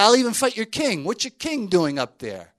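Goliath calling across the valley at the Israelites, loud and mocking; Goliath taunting. Goliath taunting Saul's army.

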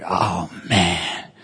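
very low, soft groan to himself; muted dread. Retold or imitated. Priest as the strangers start walking over, low muted dread. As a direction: sink into an uh-oh.

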